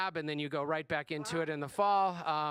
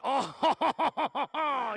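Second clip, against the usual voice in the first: loud, high hammed-up French chortle; mock French laugh; 6 semitones higher, 6 dB louder than usual. Substitute claiming to speak fluent French, hammed-up French chortle. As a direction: let out a caricature French laugh. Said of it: "'cause that's the international sound for".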